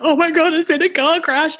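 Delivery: retold panicked cry. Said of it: "I was screaming, crying".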